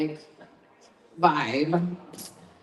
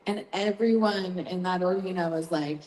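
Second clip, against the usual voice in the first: normal talking voice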